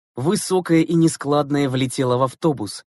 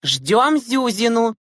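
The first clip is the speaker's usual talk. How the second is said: high, slow, drawn-out announcing; teacher's announcement; 9 semitones higher, 3 dB louder, much slower than usual. A narrator's reading. Teacher answering impatient girls on the bus, announcing. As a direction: announce it firmly to the class. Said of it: "объявила Клара Борисовна".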